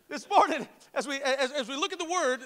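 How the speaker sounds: ordinary talk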